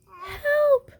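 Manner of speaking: very high, crying out; cry for help. A narrator's reading. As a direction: shriek it while tumbling. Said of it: "cried Little Owl".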